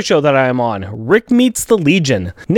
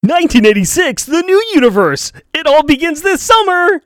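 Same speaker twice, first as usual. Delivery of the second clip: movie trailer voice; 8 semitones higher than usual. Pretend. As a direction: sell it like an epic sci-fi trailer. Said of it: "make it sound spacey".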